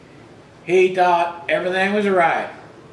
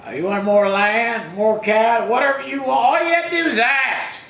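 preaching, building; 4 semitones higher, faster than usual. Acted. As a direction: build each "you want" higher until it bursts. Preacher voicing God, building to climax.

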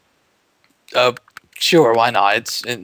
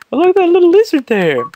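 high, loud mock outburst; 8 dB louder than usual. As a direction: belt it out high. Bill Engvall style joke.

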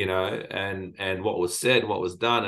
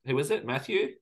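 higher, puzzled mishearing; confused caller; 7 semitones higher than usual. Retold or imitated. Caller on the phone mishears Bashir, puzzled higher voice. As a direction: play the caller who can't catch the name.

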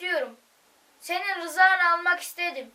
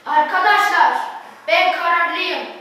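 resolute declaration; 7 dB louder than usual. Acted. Boy declaring resolve to friends.

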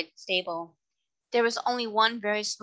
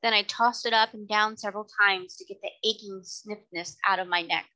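ordinary delivery

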